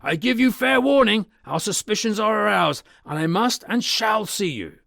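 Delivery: high, loud, threatening warning. Acted. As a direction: shout a final warning through the door. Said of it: "he resumed".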